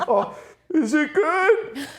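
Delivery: high, slow, laughing. Real, not acted. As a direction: squeak it out through laughter. Laughing mid-story.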